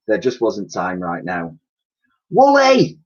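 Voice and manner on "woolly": very high, loud call; girl crying out to the mammoth on the roof. A narrator's reading. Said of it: "cried the girl".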